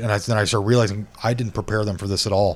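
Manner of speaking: ordinary delivery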